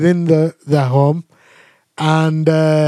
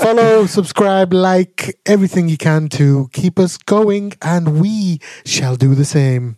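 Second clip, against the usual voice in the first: slow, measured radio host plug; slower than usual. Acted. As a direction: deliver it like a radio sign-off. Resumes trying the radio voice.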